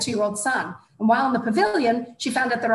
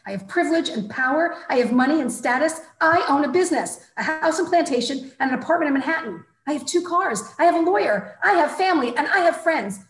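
rising indignation; 6 semitones higher, 3 dB louder than usual. A narrator's reading. Indignant boast building up.

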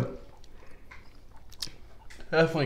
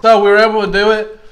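louder, talking through the burn; mouth burning from spicy food; 6 semitones higher, 4 dB louder than usual. Real, not acted. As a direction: speak with your mouth on fire. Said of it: "my mouth's on fire".

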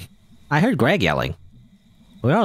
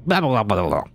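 mock gibberish. Mocking an unintelligible Cthulhu.

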